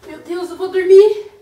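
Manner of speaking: ordinary talk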